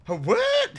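loud, high-pitched surprise; astonished squeak; startled disbelief. Real, not acted. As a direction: blurt it out, voice leaping upward. Baffled by what is happening.

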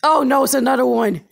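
loud, desperate begging; loud pleading. Real, not acted. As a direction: beg the enemy loudly.

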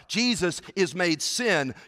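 ordinary talk, nothing marked

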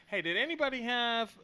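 loud, high call to the table. Acted. Server calling out to the table for an order, calling out. Acting out turning to ask the table.

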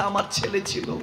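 broken murmur. Acted. Grieving king murmurs from the grave, broken. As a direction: barely get the words out.

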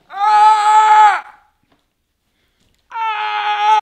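high, loud screams of frustration; frustrated scream. Real, not acted. Vlogger screaming over the endless ads, frustrated scream. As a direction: let out a fed-up scream. End of ad rant.

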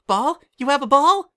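very high, loud, eager squeal; light up at the word ball; excited about a ball. Acted.

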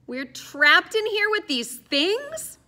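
slow, high, disbelieving; appalled. Acted. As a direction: stretch it out in horror. Molly appalled.

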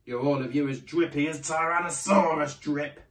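loud, stern fatherly telling-off; forceful, scornful rebuke; exasperated dad. A narrator's reading. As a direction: bark it out like a fed-up father. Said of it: "and Dad said".

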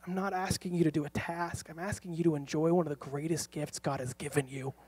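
soft, restrained plea to a son; pleading. Retold or imitated. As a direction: plead quietly, reasoning with the child. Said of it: "pleading with him".

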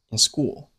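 in skimmed lightly, school full; weak in. Shown as a demonstration. Teacher showing a weak in before school. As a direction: brush past in and land on school. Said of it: "In是弱读，一带而过即可".

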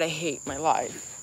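barely audible, beaten down; despairing. Real, not acted. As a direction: let it drain out of you. Upset streamer despairs aloud.

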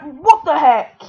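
real indignant, startled yell; startled shout. Real, not acted. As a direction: break off and yell in surprise. Attacked during the outro.

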